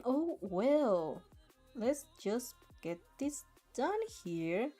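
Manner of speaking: ordinary delivery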